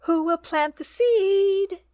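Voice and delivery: high, slow clucking call to the barnyard; hen calling loudly. A narrator's reading. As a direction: call out like a busy little hen. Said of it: "she called loudly".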